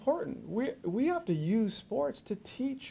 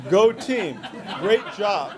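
mock cheering; 2 semitones higher, 6 dB louder, slower than usual. Shown as a demonstration. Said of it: "That's all I'm gonna say".

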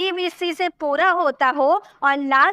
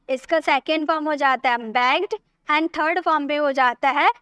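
ordinary talk, nothing marked

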